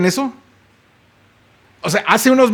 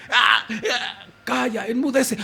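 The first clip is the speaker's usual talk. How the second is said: much softer, gentle command with a small laugh; soft, amused rebuke; 4 semitones higher, 7 dB quieter, slower than usual. Acted. Jesus calmly silencing the storm, soft gentle rebuke. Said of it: "yo me lo imagino así".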